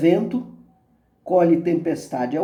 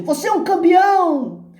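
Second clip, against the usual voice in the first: very high answering holler; high, stretched echo call; echoed shout; 13 semitones higher, 4 dB louder than usual. A narrator's reading. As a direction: fling it back like an echo. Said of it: "A voz responde".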